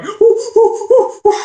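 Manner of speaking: high, loud hammed-up squeals; mock excited woo. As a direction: squeal like the thrilled lady. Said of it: "waddling around like a penguin".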